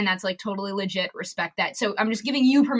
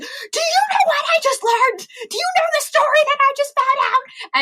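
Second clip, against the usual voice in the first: much higher, loud eager shout; excited shouting; 5 dB louder than usual. Retold or imitated. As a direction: run in bursting with news. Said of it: "running the room screaming".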